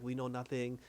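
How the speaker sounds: quiet imitation of broken-English accent; put-on broken English. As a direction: lightly mimic immigrant English, playing innocent. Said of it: "you don't really talk like that".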